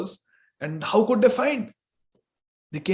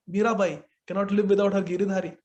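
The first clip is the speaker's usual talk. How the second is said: ordinary talk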